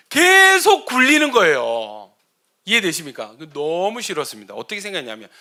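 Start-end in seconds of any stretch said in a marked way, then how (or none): none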